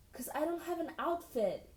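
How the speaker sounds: high mock whine; whiny voice. A narrator's reading. Girl copying his whine about clubbing, whiny. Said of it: "in the same whiny voice".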